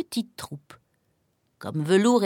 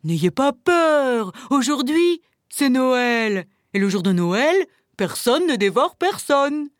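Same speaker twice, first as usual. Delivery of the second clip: big, loud wolf voice putting them at ease; reassuring wolf; 3 semitones higher, 4 dB louder than usual. A narrator's reading. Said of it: "les rassura aussitôt". (voix de loup rassurante)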